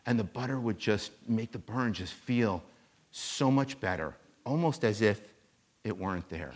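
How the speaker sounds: softly telling the butter story; real quiet voice. Retold or imitated. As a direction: draw the crowd in with a hush. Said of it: "he gets in a real quiet voice".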